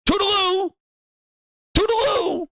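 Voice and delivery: high sing-song toodaloo; sarcastic high farewell; mocking goodbye. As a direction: wave them off mockingly.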